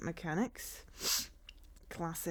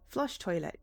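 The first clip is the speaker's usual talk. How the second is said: normal talking voice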